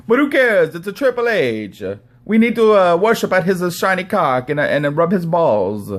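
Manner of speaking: high, loud sarcastic impression; Don Tony. Host mimicking podcaster worshipping Triple H, exaggerated fawning voice. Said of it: "Don Tony's like".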